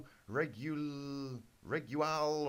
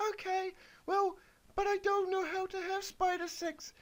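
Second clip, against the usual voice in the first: put-on much higher, squeaky girlish falsetto; squeaky Twilight voice. Twilight answers Big Mac in a high squeaky falsetto. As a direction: pitch way up into a silly cartoon squeak.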